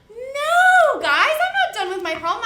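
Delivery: loud, high playful denial; hammed-up teacher no, loud and high; mock emphatic no. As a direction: exaggerate the no for the kids. Teacher answers her own quiz question.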